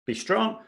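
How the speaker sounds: "strong" lifted high and held; stress on strong. Shown as a demonstration. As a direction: lean up into "strong" to show the strong beat. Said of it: "the first beat in the bar should".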